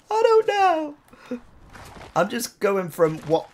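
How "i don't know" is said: squeaky clueless voice. Retold or imitated. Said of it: "And I'm like".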